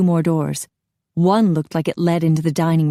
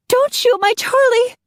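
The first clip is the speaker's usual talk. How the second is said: very high, loud shriek; wife shrieking for her husband; panicked shout; 5 dB louder than usual. A narrator's reading. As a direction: scream to protect him. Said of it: "Kelsey shouted".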